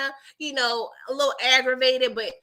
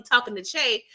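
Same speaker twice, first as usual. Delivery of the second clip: usual speaking voice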